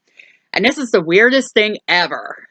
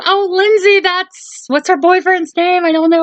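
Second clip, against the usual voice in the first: much higher, mock yelling from a trapped spot; 12 semitones higher than usual. Podcaster retelling Annie calling Lindsay from the laundry room, high mock yell. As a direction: yell up high like you're stuck and calling for help. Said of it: "[name]'s yelling".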